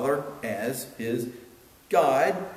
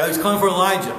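loud, higher-pitched bystander exclamation; mock excited onlookers; 7 semitones higher, 8 dB louder, much faster than usual. Onlookers mishearing the cry from the cross, mock excited. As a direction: call it out like a stirred-up onlooker.

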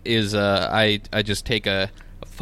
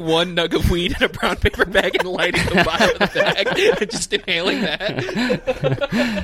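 laughing; 8 semitones higher than usual. Real, not acted. Laughing through the bit.

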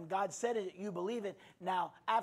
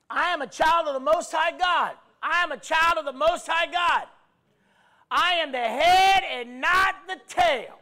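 preaching at full force; 7 semitones higher, 10 dB louder than usual. Acted. Preacher leading the congregation, full-force preaching. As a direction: proclaim each line like a battle cry.

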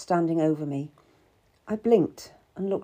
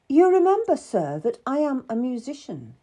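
higher, proud reminder; 6 semitones higher, 4 dB louder than usual. A narrator's reading. Cat reminds the lord she is a musician, proud. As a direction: assert your standing with pride. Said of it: "I said proudly".

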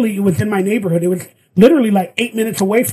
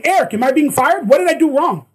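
pitched up high, shouting a question; yelling; 6 semitones higher than usual. Retold or imitated. Calling across the set to Eric, yelling in distress. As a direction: call out to the director in distress. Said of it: "And I start yelling".

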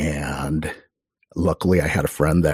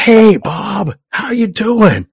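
very loud, high-pitched greeting; re-enacted friendly shout; yelling; 9 dB louder, much slower than usual. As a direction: holler hello to someone hard of hearing. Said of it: "I just yell at him".

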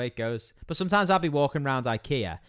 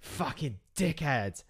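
muttered insult; 8 dB quieter, much slower than usual. Retold or imitated. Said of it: "Just be like".

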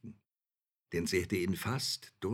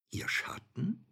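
sweet fairy tale princess; 8 semitones higher than usual. Acted. Princess surprised at shadow claim.

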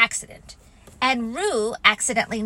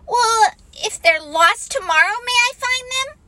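put-on higher, small child pleading; Roo pleading; 12 semitones higher, 5 dB louder than usual. A narrator's reading. Roo pleading with Kanga, high and small. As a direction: try once more, hopefully. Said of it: "Roo pleading again".

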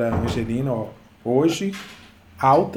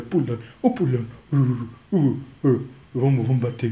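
normal talking voice

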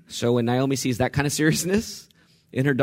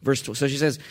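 ordinary talk, nothing marked

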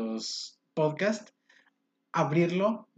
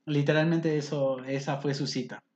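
ordinary delivery

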